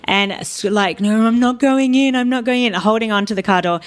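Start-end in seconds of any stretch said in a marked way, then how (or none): 1.0-2.7 higher-pitched mock wail of refusal; re-enacted childhood pleading, pitched up; retold tearful protest. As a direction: imitate a child crying and refusing, without real sobs. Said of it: "I was crying, crying, crying".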